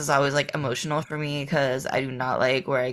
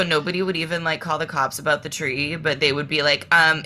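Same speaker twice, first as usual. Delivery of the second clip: ordinary talk, nothing marked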